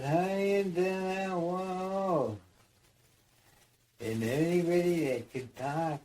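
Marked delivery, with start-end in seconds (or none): 0.0-2.4 slow, high, loud strange shout; drawn-out mock shout. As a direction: yell it across at him. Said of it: "he shouted at him".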